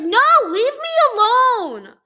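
high, very loud, slow outburst; hammed-up fed-up holler; exasperated shout. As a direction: yell it, stretching the words. Acted outburst at her mom.

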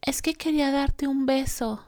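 put-on little boy's plea. Son explains to mom why he came downstairs, child's plea. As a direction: imitate a young son's innocent excuse. Voices her young son's excuse.

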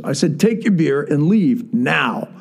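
slow, emphatic acted-out command; firm retold dismissal, each word weighted; retold stern order. Repeats order, standing up to kid.

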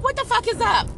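high, incredulous what-the-hell; exasperated demand. Retold or imitated. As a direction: demand answers, fed up. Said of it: "I'm like".

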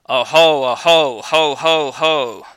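loud frightened cry of oho; anguished scream. A narrator's reading. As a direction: cry out in terror. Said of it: "he screamed".

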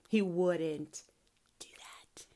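whispered, close to crying; tearful whisper. Real, not acted. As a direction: breathe it out, nearly in tears. Insisting God makes no mistakes.

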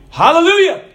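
loud command. Acted. Priest calls the car into motion, loud. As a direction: call it out to start the car.

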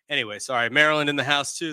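ordinary talk

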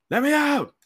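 high mock shriek while pounding the seat; imitating her scream. Hitchhiker yelling from the back seat, high mock shriek. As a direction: pitch it way up, panicked. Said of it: "banging my seat".

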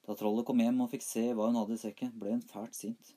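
ordinary delivery, nothing marked

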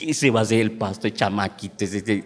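low, soft imitation of scoffers; mock the young pastor under your breath; mocking churchgoers. Churchgoers laughing at the boyish new youth pastor, mocking. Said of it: "la gente se reía de mí".

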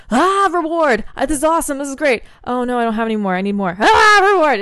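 loud, high, gleeful rush of reward; over-the-top excited craving voice; 1 excited brain voice. Pretend. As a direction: play the brain on cocaine, thrilled then craving.

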